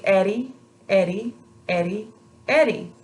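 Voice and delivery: Eddie with a very light tap behind the teeth; light tongue tap. Shown as a demonstration. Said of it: "very light touch with your tongue".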